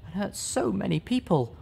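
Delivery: struggling for breath but pleading; weakened sad voice. Acted. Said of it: "her voice getting weaker as she struggled to breathe".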